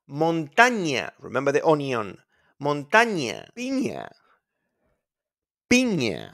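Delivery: ordinary delivery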